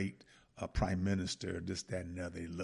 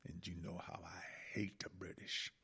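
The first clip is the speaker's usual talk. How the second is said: whispered aside; 2 semitones lower, 10 dB quieter than usual. Real, not acted. Aside about hating British.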